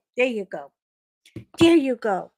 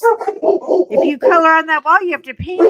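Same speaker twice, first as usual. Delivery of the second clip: loud, higher scolding voice; a hammed-up stern mom telling off her son; mock scolding; 6 semitones higher, 10 dB louder than usual. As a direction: scold a kid who drew on the wall. Mother scolding child, acted.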